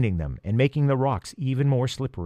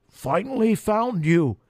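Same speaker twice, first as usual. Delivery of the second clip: high, slow, weak crow voice; weak croak; 9 semitones higher than usual. Acted. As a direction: croak it out, exhausted. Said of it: "a weak voice croaked".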